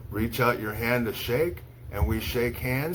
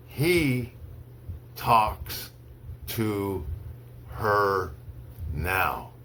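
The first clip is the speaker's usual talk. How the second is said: slow, one word at a time with long pauses; slow dictation; much slower than usual. Shown as a demonstration. Teacher reads to students for dictation, slow, word by word. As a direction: read it word by word so they can write. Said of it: "I'll say each sentence slowly three times".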